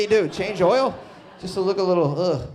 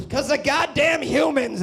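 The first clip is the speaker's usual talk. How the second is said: mock angry narrator; 9 semitones higher, slower than usual. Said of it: "he'll just get me".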